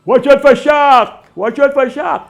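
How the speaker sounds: high, loud yell about a shark; bawling a warning. Retold or imitated. Fella on the beach bawling to the swimmer about sharks. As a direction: shout the warning across the water. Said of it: "the other fella bawling".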